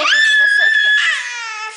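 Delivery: squealed up high with laughter; playful squeal. Real, not acted. Mother teasing her fussy toddler, laughing squeal. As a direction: tease him in a bright laughing squeal.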